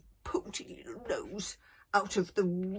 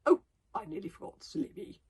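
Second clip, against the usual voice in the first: careless, airy; 9 semitones higher than usual. Acted. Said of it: "Gobber laughed carelessly".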